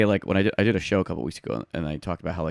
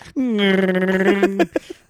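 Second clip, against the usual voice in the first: long high, loud trilled growl; wordless wookiee bellow; Chewbacca roar; 10 semitones higher, 5 dB louder than usual. Acted. Fourth sister outdoing the others, Chewbacca roar. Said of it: "it was like Chewbacca".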